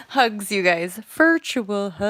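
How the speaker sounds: very loud, with a long drawn-out last word; loud send-off. Real, not acted. Streamer sends viewers virtual hugs, loud and drawn out. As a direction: call out a big hug, stretching it wide. Calling out hugs when signing off.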